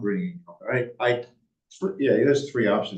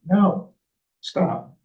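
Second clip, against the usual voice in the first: higher, louder "no", then stop; firm refusal; 9 semitones higher, 5 dB louder than usual. Retold or imitated. Board member turning down every offer, firm refusal.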